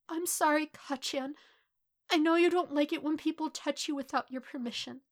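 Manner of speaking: sad, apologetic; subdued apology. A narrator's reading. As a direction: apologise after the outburst, pitched high. Shaken boy apologising before leaving.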